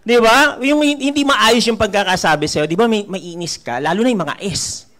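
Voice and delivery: ordinary talk, nothing marked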